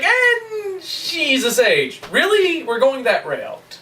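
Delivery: drawn-out, high incredulous complaint; mock disbelief. Player reacting to the sage plot twist, drawn-out disbelief. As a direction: stretch it out in exaggerated dismay. Mocking a disliked plot turn.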